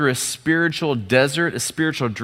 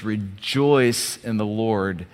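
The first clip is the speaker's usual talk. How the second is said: slow reading; 6 semitones lower, 4 dB quieter, much slower than usual. Shown as a demonstration. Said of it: "he says".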